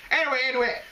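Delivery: put-on Southern accent. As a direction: play a Southern newsman in his accent. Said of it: "All right, it's back".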